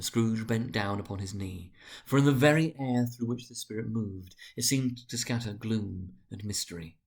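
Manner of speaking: ordinary delivery, nothing marked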